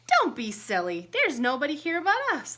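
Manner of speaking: loud, quick and high scolding. Acted. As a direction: brush him off sharply. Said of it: "the giantess scolded".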